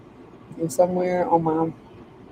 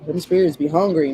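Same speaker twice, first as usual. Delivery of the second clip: normal talking voice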